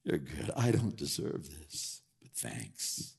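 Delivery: softly awed gratitude; humble awe. Retold or imitated. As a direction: marvel quietly before the Lord. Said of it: "We're just gonna get".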